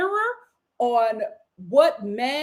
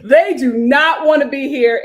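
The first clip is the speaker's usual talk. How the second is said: laughing; 10 dB louder than usual. Real, not acted. Reaction vlog, laughing emphasis.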